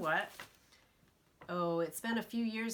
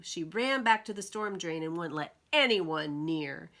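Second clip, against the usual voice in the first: ordinary delivery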